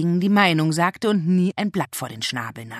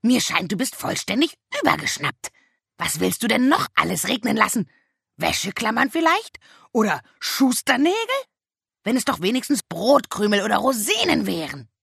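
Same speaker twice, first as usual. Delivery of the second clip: higher, mocking raven voice; indignant raven; 6 semitones higher than usual. A narrator's reading. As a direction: rail at the witch like a bossy raven. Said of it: "kreischte Abraxas". (empörter Rabe, höhere Stimme)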